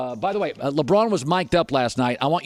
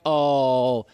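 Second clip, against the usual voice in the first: sarcastic; much slower than usual. Retold or imitated. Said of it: "And I'm like".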